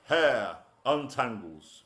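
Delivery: punchy rhythmic verse recital, lines hit hard; performance poem. Acted. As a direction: rattle off the rhymes to a live crowd.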